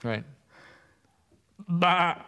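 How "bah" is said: sheep bleat. Pretend. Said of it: "sheep impression".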